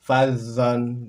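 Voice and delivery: "Fazan" loud and clear; model Arabic reading. Shown as a demonstration. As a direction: sound out "Fazan". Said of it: "Berikutnya silakan". (contoh bacaan "Fazan")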